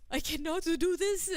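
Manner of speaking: high, loud panicked cry; overwhelmed, can't take it. Retold or imitated. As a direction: blurt it out in a panic. Panicked retelling of feeling rushed.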